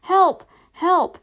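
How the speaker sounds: high, loud desperate shouts; crying for help. A narrator's reading. Frodo running down the forest path, desperate shouts. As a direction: shout each one out, high and urgent. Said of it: "ran along the path crying".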